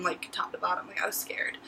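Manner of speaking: soft whisper of a scary thought; whispered fear. Real, not acted. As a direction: breathe it like a confession. Admits fear about tearing.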